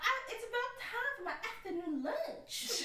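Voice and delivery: high, giggly Southern belle; hammed-up Southern drawl. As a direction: play a Southern lady, half laughing. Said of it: "those Southern belles".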